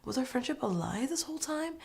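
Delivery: high, soft mock-wounded plea; put-on hurt, small high voice; playing the victim. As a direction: lay on a fake guilt trip. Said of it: "Playing the victim card".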